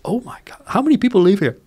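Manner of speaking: put-on a kid amazed by a huge American house; awed child. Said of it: "and I go like".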